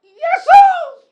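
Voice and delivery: hammed-up, high-pitched scream, very loud; piercing mock cry for help; imitated labour scream. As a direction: scream it like a wife in labour, played for laughs. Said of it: "Dia teriak-teriak".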